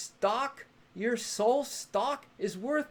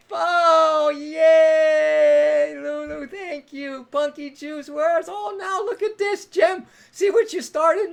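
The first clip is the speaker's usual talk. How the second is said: higher and louder, bursting with delight; thrilled; 8 semitones higher, 11 dB louder than usual. Real, not acted. Host thrilled as new viewers join the stream, excited. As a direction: greet them like a surprise party.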